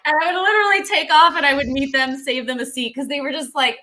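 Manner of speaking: ordinary talk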